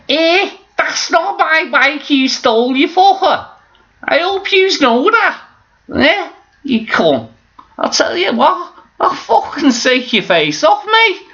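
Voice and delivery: very high-pitched put-on voice, squawking threats; hammed-up aggressive character confronting a thief; mock angry threat. Shown as a demonstration. Impression of angry bike-theft confrontation.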